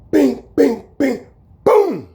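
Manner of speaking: high sharp bing bing boom; punch sound effects. Retold or imitated. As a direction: rattle off the punches.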